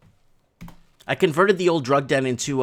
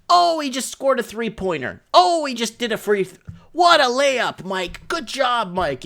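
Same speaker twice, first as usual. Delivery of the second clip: imitating an excited basketball commentator, higher; mock sports commentary; 7 semitones higher than usual. Friend imitating how he watches Mike, excited commentator. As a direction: call it like a buzzer-beater play-by-play. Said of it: "watching you like a basketball game".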